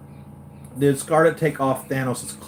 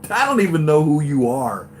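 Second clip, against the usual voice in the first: loud, higher mock villain retort; Thanos; 5 semitones higher, 7 dB louder than usual. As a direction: belt it out as an imitated villain. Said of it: "Thanos is like".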